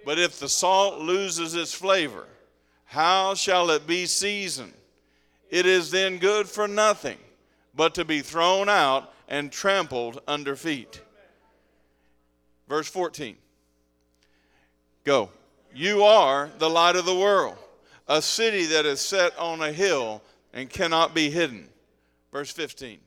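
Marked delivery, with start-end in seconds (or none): 0.0-11.0 loud, slow congregational reading; scripture read aloud. Acted. 15.7-21.7 loud, slow congregational reading; scripture read aloud. Acted.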